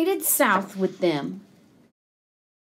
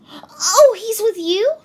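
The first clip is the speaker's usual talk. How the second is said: high mouse, loud surprised "oh"; 8 semitones higher, 5 dB louder than usual. A narrator's reading. Mouse realising he is with the geese, high-pitched. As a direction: pipe up high in sudden understanding. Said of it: "said the mouse".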